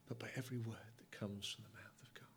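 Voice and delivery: breathy hushed whisper. Acted. God whispering scripture to his people, hushed.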